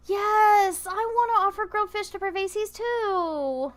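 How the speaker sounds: eager gamer cheering an option; excited. Real, not acted. Player cheering at a game option, excited. Excited about offering fish.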